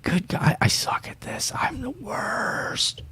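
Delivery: whispered under his breath; real whisper. Real, not acted. Fumbling with the music, he whispers to himself. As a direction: whisper it to yourself, embarrassed.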